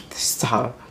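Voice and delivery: soft breath of a whisper after laughing; whispered laughing stop. Real, not acted. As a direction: whisper it, overcome by giggles. Laughing at own embarrassing story.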